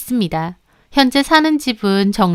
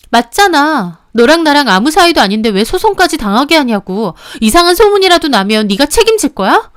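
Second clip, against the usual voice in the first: higher and louder, fed up; furious retort; 5 semitones higher, 5 dB louder than usual. Acted. Mistress tears into the husband, furious. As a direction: snap back, building to a yell. Quarrel in recorded phone call.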